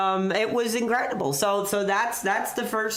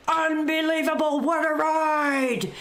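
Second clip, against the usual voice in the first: Vince's commentary shout; 7 semitones higher than usual. Retold or imitated. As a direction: roar it like ringside commentary. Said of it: "Vince saying".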